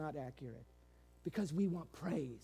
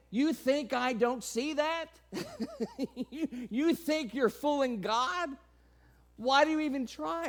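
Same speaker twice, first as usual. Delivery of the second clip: mock incredulous; 7 semitones higher, 5 dB louder than usual. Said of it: "is what he's saying".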